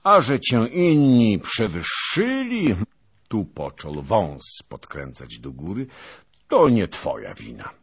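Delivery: pompous veteran holding forth at dawn; boastful old noble. A narrator's reading. Zagłoba boasting to his fellow knights, booming and pompous.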